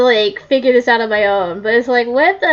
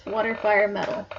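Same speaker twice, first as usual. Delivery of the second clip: muttering; 2 semitones lower, 10 dB quieter than usual. Real, not acted. Memorizing the words to herself.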